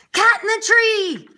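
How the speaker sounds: high, loud, slow alarm call. Acted. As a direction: shout the alert across the station. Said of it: "heard the chief call out".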